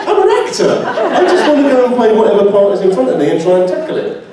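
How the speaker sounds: laughing, high-pitched mock protest. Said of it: "I'm like".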